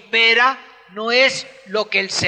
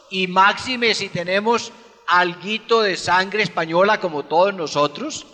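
ordinary talk

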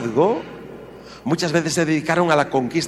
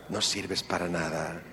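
much softer, lower "No sirves para nada"; the wounding words, repeated softly; quiet hurt echo; 7 semitones lower, 11 dB quieter than usual. Shown as a demonstration. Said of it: "repeated echo".

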